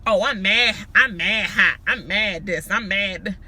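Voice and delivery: mock the classmate's catchphrase; Jersey girl. Vlogger imitating a Jersey classmate, put-on loud voice. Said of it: "this girl that went to school with me used to say".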